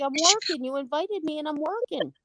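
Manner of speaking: high-pitched mock protest; retold protest. Worker retelling a reply to complaints about noise, high mock protest.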